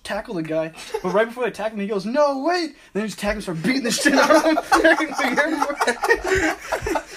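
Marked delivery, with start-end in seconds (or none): 2.1-2.7 high, loud imitation of a last plea; mock panicked cry. Traveller about to be tackled, mock panicked cry. Man cries out before tackle. 2.9-7.0 high, loud, laughing through the words; laughing hard. Real, not acted. Friend telling of an airport tackle, laughing hard. Teller cracks up at own story.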